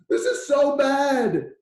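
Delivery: high, loud, slow wail of despair; hammed-up panic in the closet; mock panic. Venting to your spouse at home in a hammed-up panicked wail. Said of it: "if you've got to panic".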